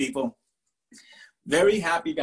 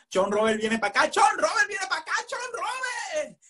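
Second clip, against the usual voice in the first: quick calls rising into a long high holler; calling out excitedly; 14 semitones higher than usual. Retold or imitated. Party crowd calling the cool friend over, excited holler.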